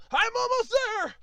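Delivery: high, strained climber's voice; climbing stairs. Pretend. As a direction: puff your way up the steps. Trudging up the stairs.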